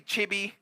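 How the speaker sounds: "Chibi" said chee-bee, soft b for v; Chevy turned into "Chibi" the Spanish way; Spanish-accented "Chibi". Shown as a demonstration. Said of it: "That's how they say it".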